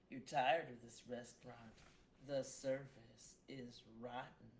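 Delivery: measured, dramatic poetry reading; poem recitation. Acted. Poet reading her dark verse to listeners, measured and dramatic. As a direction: read each line like it's carved in stone.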